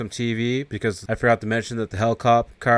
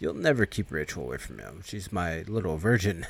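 lower, softer sinister voice; menacing devil; 4 semitones lower, 8 dB quieter than usual. Retold or imitated. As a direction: purr it with smug menace. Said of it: "He's like".

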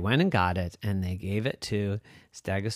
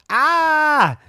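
high startled dinosaur shriek; 10 dB louder than usual. Acted. Teacup breaks.

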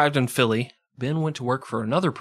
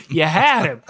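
loud, punched repeat; loud mock-gangster repeat; louder repeat; 4 semitones higher, 7 dB louder than usual. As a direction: hammer it home louder.

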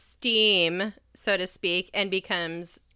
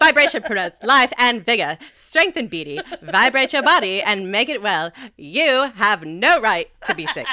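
fast, loud vintage radio ad pitch; old-timey ad voice; 3 semitones higher, 8 dB louder than usual. Shown as a demonstration. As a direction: sell it like a snake oil salesman. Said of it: "an ad lady voice".

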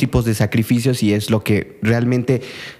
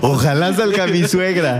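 mock wish; 6 semitones higher than usual. Voicing father's hopeful thought.

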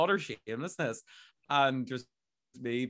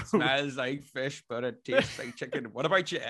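[name] parody. Retold or imitated. Quoting parody video voice.

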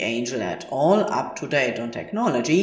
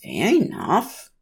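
slow, higher sulky concession; pouting; 5 semitones higher than usual. A narrator's reading. Aziraphale conceding to Crowley, slow and pouty. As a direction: give in with a pout you can hear. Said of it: "pouts Aziraphale".

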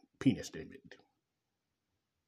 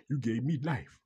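mock-adoring quote, higher and louder; mock gushing praise; 6 semitones higher, 7 dB louder than usual. Mimicking people who celebrate Mama, mock gushing. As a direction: gush it loudly, in mockery. Mocking praise given to mothers.

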